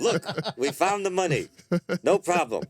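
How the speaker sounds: put-on high, laughing old-man Mel Brooks voice; Mel Brooks impression. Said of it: "He's like".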